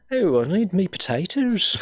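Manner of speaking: high, slow, hammed-up Irish lilt; badly faked Irish accent, drawn out and high; terrible Irish accent. Joking about Irish heritage, terrible Irish accent. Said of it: "that was a terrible accent".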